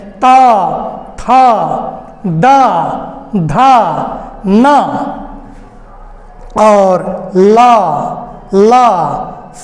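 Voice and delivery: त, थ, द, ध, न, ल, स each said alone, tongue on teeth; dental sounds demonstrated. Shown as a demonstration. Teacher sounding out dental letters for students. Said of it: "आप उच्चारण करके देख सकते हैं".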